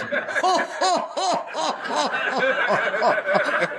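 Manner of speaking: mock belly laugh in high bursts; imitated laughter. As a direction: act out his long, pulsing laugh. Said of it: "he goes like this".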